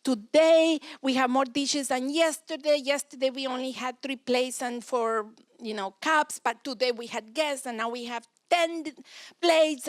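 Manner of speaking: put-on high, loud protesting kid, building to a loud "plates"; a child protesting unfair dishes, loud and whiny; whiny child complaint. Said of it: "flipping into Russian".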